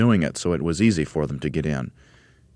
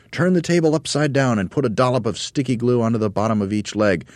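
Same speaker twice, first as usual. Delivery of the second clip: raised, brisk-minded instructions; Mugglewump shouting; 7 semitones higher, 4 dB louder than usual. A narrator's reading. Monkey leader directing table work, shouting. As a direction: give orders in a lifted voice. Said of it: "shouted Mugglewump".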